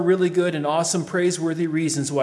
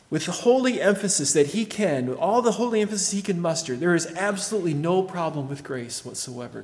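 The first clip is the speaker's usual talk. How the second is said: ordinary talk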